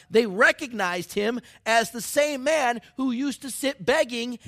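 loud, high, jubilant preaching; preaching at full force. Acted. Pastor telling the crowd, at full shouting force. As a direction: shout the miracle to the rafters.

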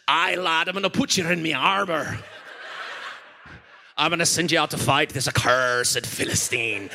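lightly voiced old pirate captain; a hint of a salty pirate king; Captain Barbosa voice. Pastor voicing King Saul for a toddler, as a lightly voiced pirate. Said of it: "Saul sounds like Captain Barbosa".

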